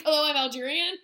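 loud mock Algerian accent; hammed-up Algerian-accented hello; Sophia Boutella. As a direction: imitate the actress announcing herself, rising at the end. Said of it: "she's like".

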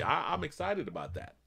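ordinary talk, nothing marked

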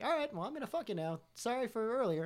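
imitated brisk proposition. Acts out partner's sudden brisk proposition.

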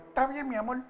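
meek reply. Retold or imitated. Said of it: "yo hago".

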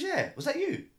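surprised. Retold or imitated. Passenger's reply retold.

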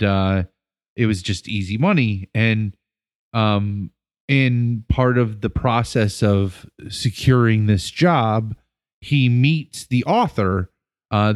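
ordinary delivery

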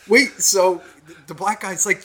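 normal talking voice

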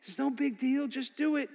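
lightly voiced devil luring a man in; Satan's tempting voice. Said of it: "that voice from Satan that says".

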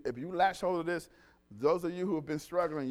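ordinary delivery

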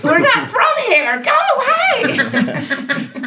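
very high hammed-up yell, last words stretched; comic shriek breaking into laughter; mock scream. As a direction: screech to chase a stranger off. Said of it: "don't think we screamed".

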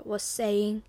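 usual speaking voice

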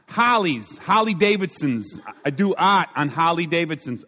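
a hint of an excited motorcycle airbrusher; biker fan. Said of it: "he goes".

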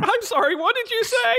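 very high put-on voice; panicked captive character. Captive asking the party that plans to kill him, high-pitched panic. Game master plays Carol overhearing the plot.

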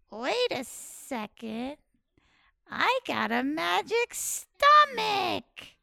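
drawn-out, slow sudden idea; slowly dawning plan; slow realization. Acted.

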